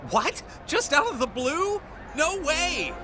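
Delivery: startled refusal. Acted. Balks at a too bold suggestion.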